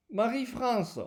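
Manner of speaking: ordinary talk, nothing marked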